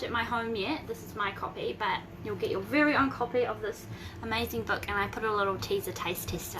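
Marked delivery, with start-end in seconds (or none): none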